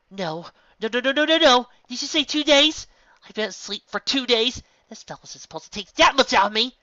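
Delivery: loud, high panicked denial; rising panic, no no no. Acted. Horror washes over Twilight.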